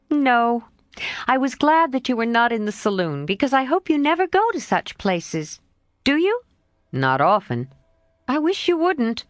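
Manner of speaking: Jo's voice. Acted. Jo answering her friend Laurie, lightly voiced girl.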